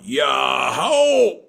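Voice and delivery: full loud group shout; crowd shout. Acted. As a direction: shout it back like the whole village. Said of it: "And the people all went".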